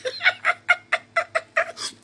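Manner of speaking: breathy high-pitched cackle; shrill laughing fit. Real, not acted. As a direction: burst out in a squeaky laugh. Laughing at people.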